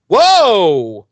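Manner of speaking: loud high startled whoa; recoiling in disgust. Retold or imitated. Glory tasting Tara and recoiling, loud whoa.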